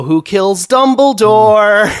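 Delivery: high, loud sing-song teasing; loud, gloating imitation of a taunt; mocking taunt. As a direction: draw it out slowly, making fun of him. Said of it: "making fun of him way".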